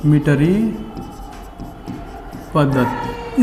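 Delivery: मीटरी, long pause, पद्धति dropping low; slow dictation. Shown as a demonstration. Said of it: "इसको बोलते हैं".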